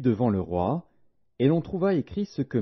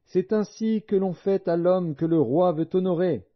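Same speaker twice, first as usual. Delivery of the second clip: high, sustained public proclamation; proclaiming; 8 semitones higher than usual. A narrator's reading. Aman tells the king what to cry before him, proclaiming. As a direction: cry it out before the honored man, pitched high without shouting. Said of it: "et crier devant lui".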